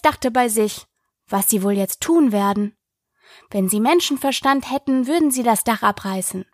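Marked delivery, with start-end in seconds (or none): none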